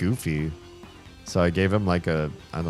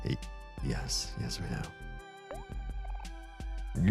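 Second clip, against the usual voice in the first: whisper; 13 dB quieter, much slower than usual. Acted. Tiny scared character reply.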